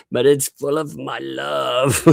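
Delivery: slow, high, hammed-up plea; drawn-out pretend begging; mock pleading. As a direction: plead sweetly in a put-on high voice. Mock plea, then laughing refusal.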